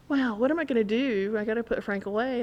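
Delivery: ordinary talk, nothing marked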